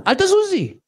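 high, sharp command not to move; commanding rabbi. Retold or imitated. Rabbi David telling the woman not to move, a high sharp order. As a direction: order her to stay still.

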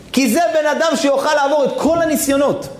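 soaring sermon peak; fervent preaching. Acted. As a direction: drive the point home.

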